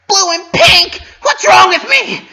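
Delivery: high, loud, anguished cry; anguished shout. Acted. Pinky berating herself over the cake, high anguished shout. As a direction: explode in self-reproach, high and loud.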